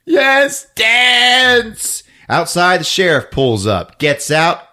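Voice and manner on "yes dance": high, drawn-out hammy cry; mock villainous command. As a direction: order it like a hammed-up villainess. Villain's order acted out.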